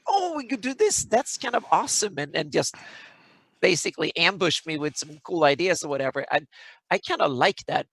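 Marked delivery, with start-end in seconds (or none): 0.1-2.1 high, eager excited pitch; excited players. Retold or imitated. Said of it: "players just come out with".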